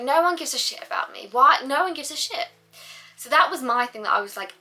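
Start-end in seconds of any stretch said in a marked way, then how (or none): 0.0-2.5 retold sulky whine. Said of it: "And I'd be like".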